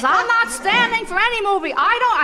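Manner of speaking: high, loud stubborn protest; stubborn husband. Retold or imitated. High and loud, husband refusing to stand for a movie. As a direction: play the husband digging in his heels.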